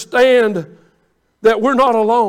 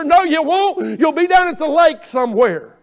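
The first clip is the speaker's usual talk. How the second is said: high, scolding comeback; raised-voice preacher's retort; mock retort; 5 semitones higher, 4 dB louder than usual. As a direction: call out the empty promise. Preacher retorts to the mimicked member.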